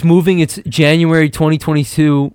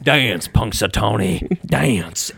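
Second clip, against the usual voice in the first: cruel manager; 6 semitones lower, 4 dB quieter, much slower than usual. Acted. Evil manager taunts groundhog.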